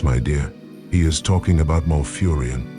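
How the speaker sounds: ordinary talk, nothing marked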